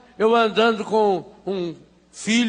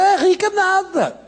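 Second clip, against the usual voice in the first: maids gossiping; 7 semitones higher than usual. Retold or imitated. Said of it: "Eu falei".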